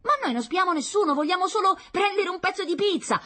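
put-on very high, loud child voice; protesting child. Child pleading for a piece of pizza, protesting.

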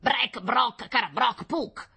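put-on high squawking old man's cries; shouted alien gibberish. A narrator's reading. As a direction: croak out irritated nonsense syllables. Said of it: "gridava il vecchio". (grida gracchianti e incomprensibili)

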